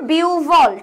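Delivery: "বিউভল" with a long biu, then a falling bhol; correct pronunciation. Shown as a demonstration. Teacher gives students the right answer, "বিউভল" drawn out as biuu-bhol. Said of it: "আমি বললাম".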